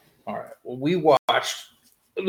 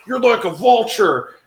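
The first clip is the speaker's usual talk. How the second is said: higher, louder mock-dramatic quote; imitating show character; 8 semitones higher, 5 dB louder than usual. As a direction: raise it, imitating the guy's line. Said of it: "he's like".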